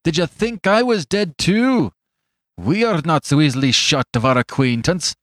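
high, forceful challenge; grim confrontation. Acted. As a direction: throw it in his face as an old accuser. Fetz confronts his old associate.

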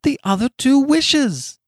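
high, loud, feverish; high-pitched urgency; urgent excited reply. A narrator's reading. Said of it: "she replied rapidly".

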